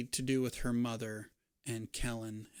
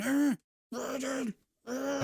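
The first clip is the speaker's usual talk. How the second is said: high slow cry through a gag; muffled gagged cry; 13 semitones higher, 3 dB louder, slower than usual. Acted. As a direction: strain to call out with a bound mouth. Gagged girl calls out.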